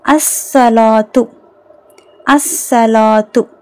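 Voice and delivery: slow "அஸ்ஸலாத்து", no L in the article, long doubled S; silent lam. Shown as a demonstration. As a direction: model "அஸ்ஸலாத்து" slowly, A straight into held S. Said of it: "லாம் உச்சரிக்கப்படலை". ("அஸ்ஸலாத்து" லாம் இல்லாமல், ஸ் நீட்டி)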